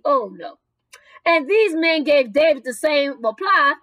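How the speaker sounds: ordinary talk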